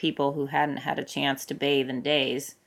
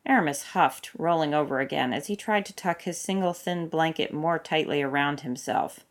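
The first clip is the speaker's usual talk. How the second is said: usual speaking voice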